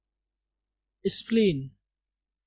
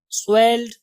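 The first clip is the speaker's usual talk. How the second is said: ordinary talk, nothing marked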